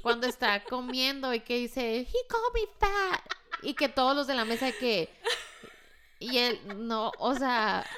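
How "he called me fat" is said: high quoted film line; mock wounded whine.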